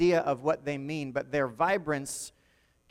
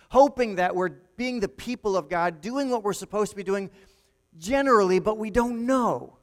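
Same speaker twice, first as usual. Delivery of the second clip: loud, higher-pitched preacher's build; impassioned preaching; 3 semitones higher, 6 dB louder than usual. Real, not acted. Preacher warning the church about drifting without direction, impassioned and loud. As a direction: drive it home loudly, slowing on the last phrase.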